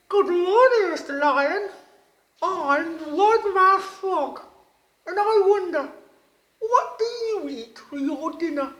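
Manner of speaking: high, loud, bright-eyed little frog voice; wide-mouthed frog voice. A narrator's reading. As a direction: squeak it out like an eager little frog. Frog voice greeting the lion.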